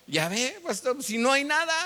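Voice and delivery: high-pitched retold scoff; imitated taunt, rising high; mocking youth. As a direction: jeer like a young skeptic, pitch climbing high. Young man scoffs at pastor.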